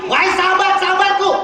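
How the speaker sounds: high, loud address to a gathering; calling out. Acted. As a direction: call out to your companions. Prophet calls out to his companions.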